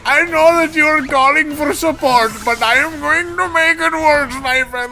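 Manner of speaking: high, loud, booming ho-ho-ho villain laugh; gleeful over-the-top villain boasting; cartoon villain. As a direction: ham up an evil support agent gloating. Support voiced as gleeful villain.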